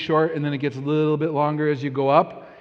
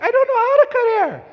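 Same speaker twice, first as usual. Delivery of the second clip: high, louder mock wife voice; squeaky put-on wife protest; imitating wife; 8 dB louder than usual. Husband mimics wife saying she can't cut hair, high mock voice. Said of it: "She's like".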